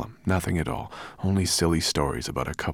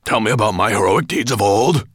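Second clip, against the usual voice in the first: loud, pompous growling command; growled; 5 semitones higher, 10 dB louder than usual. A narrator's reading. Dragon demanding tales of his deeds, growling loudly. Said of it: "he growled".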